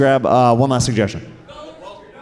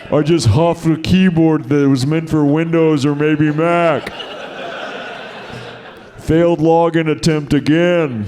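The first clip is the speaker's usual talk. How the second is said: duster-huffer voice; 2 semitones higher than usual. Shown as a demonstration. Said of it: "you start talking like this".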